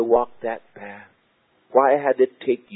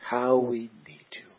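breathy whisper of need; whispered prayer; 6 dB quieter than usual. Acted. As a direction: whisper it like a private plea. Whispered repeat of prayer line.

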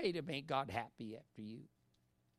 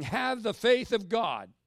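slow, loud and high, every word hammered; booming proclamation; 7 semitones higher, 12 dB louder, slower than usual. Acted. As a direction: thunder it out like a prophet. Preacher booming God's words.

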